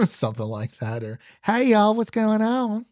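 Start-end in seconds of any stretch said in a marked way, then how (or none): 1.4-2.9 high-pitched, hammed-up Southern accent; worst Southern accent. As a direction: greet folks in a badly faked Southern drawl. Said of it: "I have the worst Southern accent".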